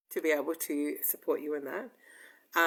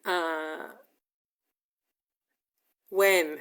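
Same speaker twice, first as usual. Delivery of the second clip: ordinary talk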